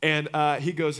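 ordinary talk, nothing marked

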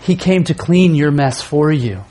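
ordinary talk, nothing marked

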